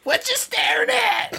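high, slow put-on grouch; mock hostile challenge. Grump snapping at friends admiring his face, mock hostile. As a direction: challenge them in a hammed-up high voice. Stays in grumpy refuser act.

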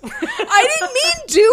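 high, slow, laughing whine; giggling defense of herself; laughing plea. Real, not acted. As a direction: plead innocence through real laughter.

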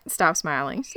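ordinary delivery

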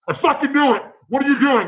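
high-pitched, alarmed scolding; exasperated friend. Retold or imitated. Best friend tailing the bartender outside, exasperated and high-pitched. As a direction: act out a friend catching you red-handed, pitch jumping up.

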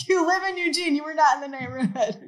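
playful, emphatic objection; mock disbelief. Retells challenging friend's unlikely excuse.

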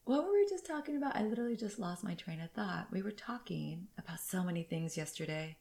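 half-whispered, to herself; quiet muttering. Real, not acted. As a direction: drop into a near whisper. Loses her train of thought.

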